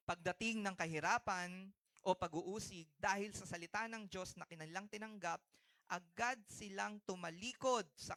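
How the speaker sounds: booming unison verse reading from the pulpit; scripture reading. Acted. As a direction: read it out together like a congregation. Congregation reads verse aloud.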